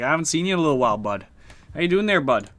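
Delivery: imitated folksy Canadian greeting, lifted in pitch; Canadian accent, put on for fun; Winnipeg Canadian accent. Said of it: "Winnipeg Canadian".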